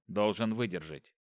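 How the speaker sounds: ordinary delivery, nothing marked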